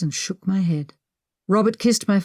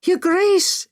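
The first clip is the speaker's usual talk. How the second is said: high call after someone; a maid calling after her mistress; 12 semitones higher than usual. A narrator's reading. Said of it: "Ella called after me".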